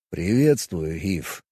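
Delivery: calm, detached alien; Vulcan voice. Acted. Alien Vulcan greets in low voice.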